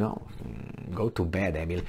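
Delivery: hushed, breathy go-to-bed murmur; mock tired sigh. Friends telling Emil to go to bed, hushed. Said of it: "who talk to you, think".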